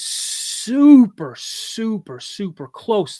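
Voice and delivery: "super" stretched long and pitched high; drawn-out emphasis. Real, not acted. Storyteller stresses nearness of the crack, "super" drawn out. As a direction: lean hard on each "super", slowly.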